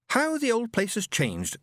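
high, slow wry remark; a young woman's wry comment; sister's voice. Acted. Sister, wrinkling her nose.

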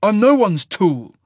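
loud, slow, high defiance; snapped. Acted. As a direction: bite off every word in anger. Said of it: "Cole snapped".

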